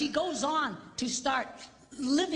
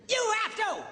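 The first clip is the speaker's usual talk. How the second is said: loud, high insistence; emphatic shout; 8 semitones higher, 4 dB louder than usual. Real, not acted. Preacher shouts at the ladies in the congregation. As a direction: drive it home to the ladies. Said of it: "Ladies, are you listening to me?".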